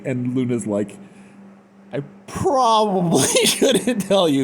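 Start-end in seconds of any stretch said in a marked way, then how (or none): 1.9-4.4 high, stretched-out, laughing hesitation; mock reluctance. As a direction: squeak it out with comic unease. Voices Luna reluctant to read letter.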